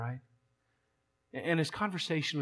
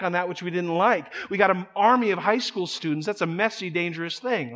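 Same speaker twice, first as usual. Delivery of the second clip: louder, pressing preaching drive; fired-up preaching; 3 semitones higher, 6 dB louder than usual. Acted. Pastor pressing the church on truth and power, fervent. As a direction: preach it home with force.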